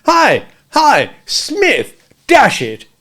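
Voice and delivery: high, loud indignant shouts; calling loudly. A narrator's reading. Pink youth calling after Smith, loud and indignant. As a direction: yell after him from the club steps. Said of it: "who called loudly".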